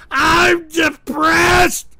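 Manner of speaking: slow, drawn-out wailing cry. Real, not acted. Streamer wailing to chat that he is depressed. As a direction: wail it with a sob.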